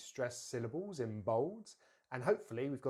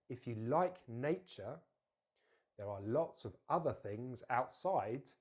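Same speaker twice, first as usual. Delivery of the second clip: slow, careful model for learners; measured pace, clear stresses; slower than usual. Shown as a demonstration. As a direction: take it slowly, phrase by phrase. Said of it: "very slowly".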